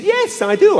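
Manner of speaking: high lady voice. Retold or imitated. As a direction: squeak it like a proper lady. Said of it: "And she said".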